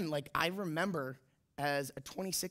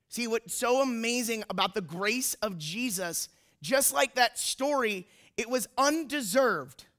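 impassioned preaching; 5 semitones higher, 4 dB louder than usual. Real, not acted. Preacher on undeserved grace, impassioned rising delivery. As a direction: build louder and higher toward the climax.

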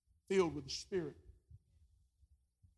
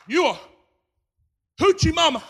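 higher, louder hammed-up accusation; mocking a harsh preacher; 11 semitones higher, 10 dB louder, faster than usual. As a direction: send up a preacher who scolds her. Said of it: "I've heard preachers get up".

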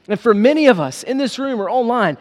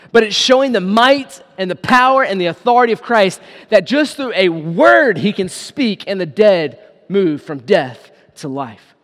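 normal talking voice

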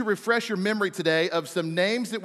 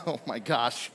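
laughing in embarrassment; 8 semitones lower, 6 dB quieter than usual. Real, not acted. After hitting a churchgoer.

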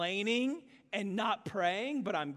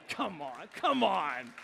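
ordinary talk

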